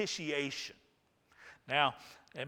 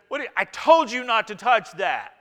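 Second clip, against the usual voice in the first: louder, higher scolding parent; mock harsh parent; 6 semitones higher, 10 dB louder than usual. Pastor mocking a scolding parent, mock harsh voice. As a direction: scold the kid in a hammed-up parent voice.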